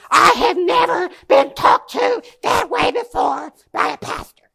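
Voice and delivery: high, indignant imitation of the offended young woman; her indignant outburst. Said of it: "That's exactly how she sounded".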